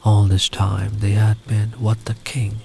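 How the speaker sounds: ordinary delivery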